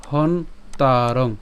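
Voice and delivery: হন তারং said slowly with a break after হন; slow syllable-by-syllable হন তারং; split syllables. Shown as a demonstration. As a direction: pause between হন and তারং, drawn out. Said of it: "হ এবং দন্তান পর্যন্ত উচ্চারণ করছি".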